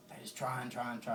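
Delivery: ordinary delivery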